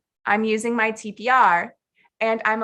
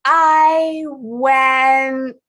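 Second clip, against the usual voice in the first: louder, drawn-out "I... went", searching for words; struggling student; 3 semitones higher, 6 dB louder than usual. Pretend. Student answers what she did yesterday, hesitant and stretched. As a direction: stretch each word while hunting for the answer.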